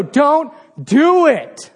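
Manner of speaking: calling out. Retold or imitated. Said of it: "And you just go".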